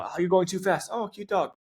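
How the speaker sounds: high-pitched imitated passerby, scolding then charmed; mock scolding turned cooing. People on a bike path, mock scolding turning sweet. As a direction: imitate a complaint melting into delight.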